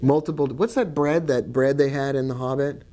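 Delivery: ordinary delivery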